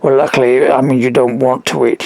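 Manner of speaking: ordinary talk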